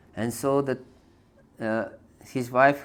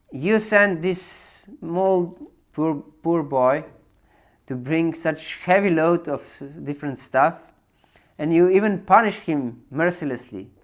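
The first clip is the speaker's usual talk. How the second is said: raised, higher voice scolding her husband; upset scolding; 5 semitones higher, 5 dB louder than usual. Acted. Wife reproaches her husband for beating the boy, upset and raised. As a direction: let the hurt and blame pour out. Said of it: "she was very upset".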